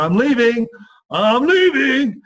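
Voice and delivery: high, loud, slow wail; a child loudly wailing she is leaving; whiny child. Retold or imitated. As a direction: drag out a child's threat. Girl threatens to run away.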